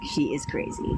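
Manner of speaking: whispered aside, She is crazy. Real, not acted. Confiding to viewers about the ex, whispered.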